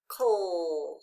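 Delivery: "coal" drawn out low, falling; long "coal" sinking in pitch; isolated final syllable. Shown as a demonstration. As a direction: stretch "coal" as one lone syllable. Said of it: "the final stable syllable".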